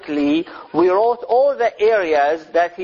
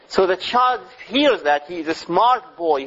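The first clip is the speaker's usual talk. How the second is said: usual speaking voice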